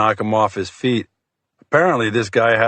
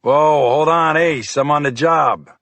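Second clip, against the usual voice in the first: calling out; 5 semitones higher than usual. Acted. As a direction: call out sharply to halt him. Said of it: "The man called out".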